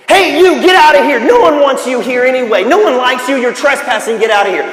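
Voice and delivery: loud, high shout to scare someone off; yelling voice. A narrator's reading. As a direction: yell it at an unwelcome trespasser. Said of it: "some voice yelling at you".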